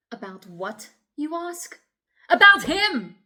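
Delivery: building to a high, loud cry; agitated outburst. Acted. Woman answering officer about the man ahead, agitated. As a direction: throw the question back, then explode.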